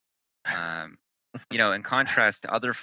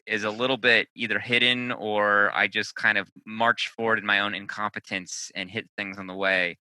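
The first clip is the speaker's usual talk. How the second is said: ordinary talk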